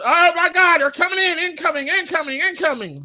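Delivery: high frantic calling out; a dad yelling incoming mid-game; high hammed-up panic; mock panicked dad gamer. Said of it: "like a dad playing".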